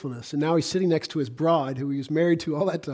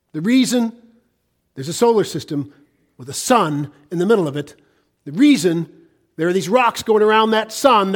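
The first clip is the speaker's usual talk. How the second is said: raised, forceful pulpit delivery; emphatic preaching; 5 semitones higher, 7 dB louder than usual. Real, not acted. Preacher declaring to the congregation why there is a cosmos, emphatic. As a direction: preach it out, lifting each phrase higher.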